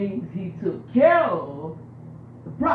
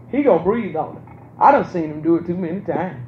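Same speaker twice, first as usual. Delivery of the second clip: usual speaking voice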